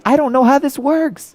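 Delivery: put-on high, slow, whiny teen voice; teen son whining to his dad, high and slow; helpless teen. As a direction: sound lost and helpless.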